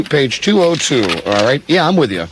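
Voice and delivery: usual speaking voice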